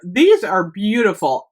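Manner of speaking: ordinary talk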